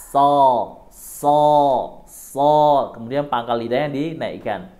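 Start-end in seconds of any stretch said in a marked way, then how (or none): 0.1-2.5 "So" louder, heavy emphatic s; thick so. Shown as a demonstration. Teacher drills "so" for students, loud and heavy. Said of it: "ada di gigi seri bawah".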